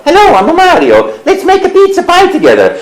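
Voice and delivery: cheerful Mario inviting you to make a pizza. Acted. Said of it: "I go".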